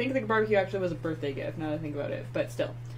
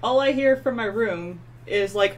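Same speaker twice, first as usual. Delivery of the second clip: ordinary delivery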